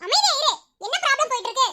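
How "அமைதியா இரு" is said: sharp rebuke. Acted. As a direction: shut the friend up firmly. Snapping at friend to shut up.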